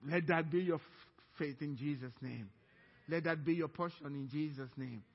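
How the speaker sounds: ordinary talk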